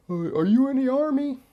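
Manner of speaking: high, questioning stranger's voice; curious stranger. Retold or imitated. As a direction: ask like a puzzled passer-by. Imitating passers-by asking him.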